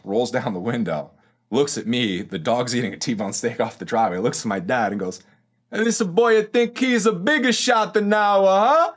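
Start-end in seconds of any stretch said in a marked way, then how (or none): 5.7-8.9 much higher, louder, slow mocking old Italian grandfather; grandfather's jab. Grandfather to the dad from his car window, high mocking jab. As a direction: tease him like an old Italian nonno, drawn out.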